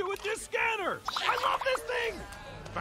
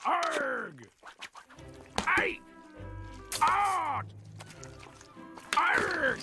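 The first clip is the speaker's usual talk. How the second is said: pirate shouts; 7 semitones higher, much slower than usual. Acted. Pirate cries.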